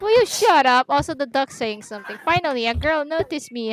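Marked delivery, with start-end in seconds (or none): 0.0-0.9 high-pitched, irritated demand for silence; exasperated. Acted. Snapping at friends who keep talking, exasperated. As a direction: cut them off, fed up and higher. Fed up with others talking over her.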